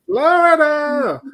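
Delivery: high, drawn-out announcer holler; announcer call. Pretend. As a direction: stretch it out long and high. Host announces the story's location.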